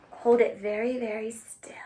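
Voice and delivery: hushed whisper to keep still; tiny whispered hush. Real, not acted. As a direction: whisper softly so no one moves.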